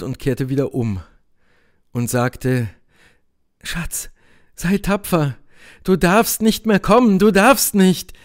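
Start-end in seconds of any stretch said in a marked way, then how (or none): 3.6-8.1 pleading Helene. A narrator's reading. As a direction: urge the beloved to be brave, increasingly insistent. (flehende Helene, eindringlicher werdend)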